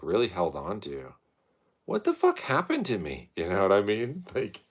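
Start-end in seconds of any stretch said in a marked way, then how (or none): 1.8-3.3 high exclaimed question; high, baffled outburst at normal volume; incredulous. Real, not acted. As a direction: ask in bafflement, pitched up. Exclaims over his trauma.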